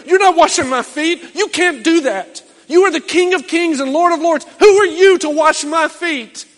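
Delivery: Peter protesting. Retold or imitated. Peter refusing to let Jesus wash his feet, loud and indignant. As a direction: refuse him, outraged and loud. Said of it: "be like Peter".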